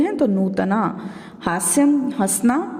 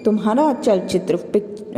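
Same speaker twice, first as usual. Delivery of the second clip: ordinary talk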